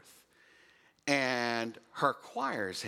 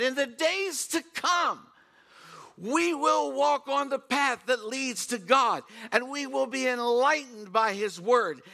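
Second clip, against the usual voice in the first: higher, louder and slow, preaching a prophecy; scripture recital; 6 semitones higher, 4 dB louder, slower than usual. Acted. Preacher proclaiming Isaiah's prophecy to the congregation, slow and loud.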